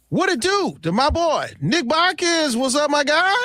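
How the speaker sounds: high, swooping hype-man welcome; hyped guest greeting. Real, not acted. Radio host welcoming his guy on air, hyped and high-pitched. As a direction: hail him in a high, playful lilt.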